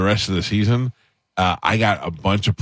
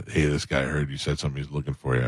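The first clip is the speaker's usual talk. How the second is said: mock hushed warning; 6 semitones lower, 7 dB quieter than usual. People warning the host someone's after him, hushed and lower. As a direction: lower and much softer, like a tattler warning him.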